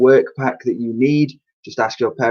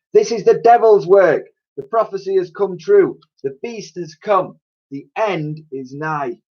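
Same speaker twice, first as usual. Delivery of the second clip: doom preacher crying out; 7 semitones higher than usual. Acted. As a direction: wail it like a street prophet.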